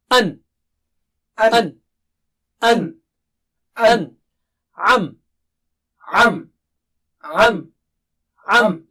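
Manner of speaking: An and Am, each clipped and clear; syllable by syllable, crisp; separate syllables. Shown as a demonstration. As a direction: drill each syllable alone. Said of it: "practice the syllables separately".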